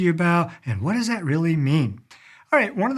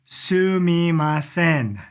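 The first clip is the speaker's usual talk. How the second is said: slow, stretched SUMIMASEN, syllable by syllable; drawn-out syllables; 2 semitones higher, much slower than usual. Shown as a demonstration. Teacher introducing a new Japanese word, slow and drawn out. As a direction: sound out Sumimasen very slowly and evenly.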